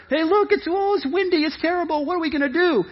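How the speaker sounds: high-pitched fretting disciples; mock panic. Disciples wake Jesus about the storm, high mock panic. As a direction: fret helplessly in a high voice. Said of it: "They begin to panic".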